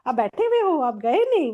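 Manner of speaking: high, loud startled question; mock the guru's surprise at finding her still there; a surprised teacher's high exclamation; retold surprise. Guru surprised she stayed.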